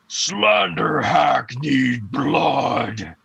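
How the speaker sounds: very slow, drawn-out monster call; ominous creature, long stretched vowels; echoing monster voice. Acted. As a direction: let each word roll through a dark chamber. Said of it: "you hear this voice echo from this chamber".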